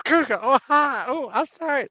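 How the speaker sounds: put-on high squeaky girl voice, far up; girl from the meme. Retelling a meme to friends, high mock girl voice. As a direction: imitate the flustered girl in the meme. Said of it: "That meme I made where the girl goes".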